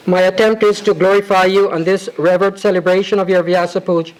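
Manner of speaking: ordinary delivery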